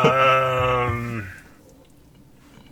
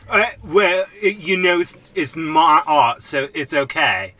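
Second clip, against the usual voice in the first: higher, halting Cockney impression that drifts into Michael Caine; badly faked Cockney, pitched up and drawn out; attempted Cockney accent; 6 semitones higher, 4 dB louder than usual. Podcaster correcting how the actor said it, hammed-up Cockney. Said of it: "a little like your Michael Caine accent".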